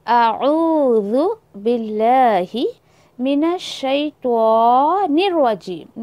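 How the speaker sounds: slow Arabic recitation of अऊज़ु बिल्लाहि, each letter drawn out; careful recitation. Shown as a demonstration. Teacher demonstrating to students, slow careful Quranic recitation. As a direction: recite मिनश शैतानिर रजीम slowly, merging the nun into the ra. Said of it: "नून को फ़ौरन उठाकर जो है वो रॉ के ऊपर मर्ज करिए".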